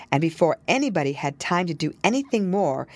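usual speaking voice

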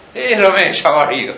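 high, loud call-out to an acquaintance; booming, high-pitched hello; hearty greeting. Acted. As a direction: greet someone loudly across a room. Acting out someone greeting the wearer.

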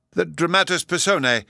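ordinary delivery

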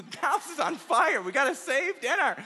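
high, loud, hammed-up panic; frantic mock shout. Imitating Grandma rescuing dinner from the burning house, frantic shout. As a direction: shout it like a comic emergency. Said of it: "She's like".

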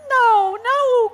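high, loud cry of refusal; alarmed parents' acted outcry; cried out. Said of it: "cried their parents".

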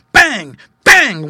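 loud, high, explosive exclamations; gunshot imitation. A narrator's reading. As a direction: fire off each word like a shot. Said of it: "went the gun".

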